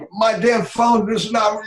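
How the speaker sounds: high, loud exasperated shout; angry outburst. Shown as a demonstration. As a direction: snap at the phone in frustration. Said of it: "and your voice changes".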